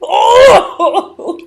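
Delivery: high, loud hammed-up wail breaking into laughter; mock wail. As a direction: fake anguished cry. Mock wailing over her talent.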